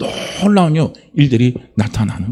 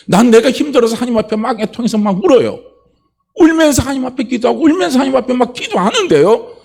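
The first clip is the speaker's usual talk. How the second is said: full-force preaching; 9 semitones higher than usual. Acted. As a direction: build the sermon toward its peak. Preaching builds up.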